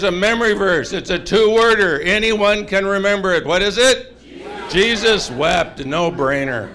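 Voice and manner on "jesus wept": ordinary delivery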